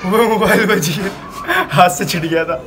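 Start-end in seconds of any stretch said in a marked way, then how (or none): 0.1-1.1 relieved laugh. Real, not acted. Phone nearly dropped.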